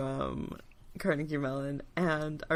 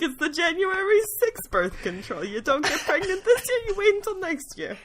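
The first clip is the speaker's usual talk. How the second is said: mock ad voice; 12 semitones higher than usual. Mock birth control ad.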